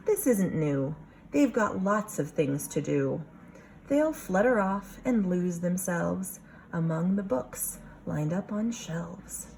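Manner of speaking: normal talking voice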